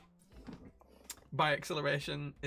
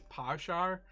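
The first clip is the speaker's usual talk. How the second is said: "parshar" said the Irish way, power shower squeezed to two short syllables; Irish accent; 4 semitones higher than usual. Shown as a demonstration. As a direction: say "parshar" with a clipped Irish vowel. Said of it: "a phrase to try and do an Irish accent".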